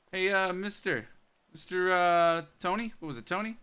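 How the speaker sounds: calling out loud and high. Real, not acted. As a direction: shout across to Tony. Calling to Tony.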